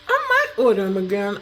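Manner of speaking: talking with a mouth full of chips. Real, not acted. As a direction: say it through a mouthful of food. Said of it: "I won't talk with my mouth full".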